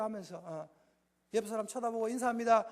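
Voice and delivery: usual speaking voice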